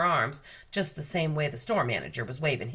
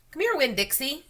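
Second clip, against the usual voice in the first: calling a dog; 11 semitones higher, 3 dB louder than usual. A narrator's reading. Names and calls the dog.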